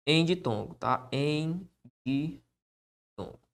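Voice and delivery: slow, soft dictation to the board; dictating while writing. Shown as a demonstration. Teacher dictating the rule as he writes it, slow and soft. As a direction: spell it out slowly as you write.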